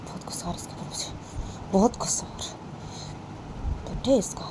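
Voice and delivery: whispered, seething under the breath; soft furious muttering; angry whisper. Acted. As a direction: hiss the complaint quietly. Bani seething under her breath.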